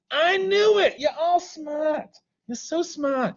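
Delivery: high, put-on gushing praise; mock gushing. Teacher praising the class as so smart, high mock gushing. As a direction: gush over them in a silly high voice.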